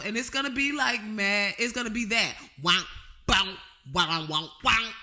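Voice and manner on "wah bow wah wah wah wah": louder wah-wah bass by mouth. Shown as a demonstration. Threatening to make the bass himself, louder wah-wah mouth bass. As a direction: play the bass with your mouth. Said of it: "do it myself with my mouth".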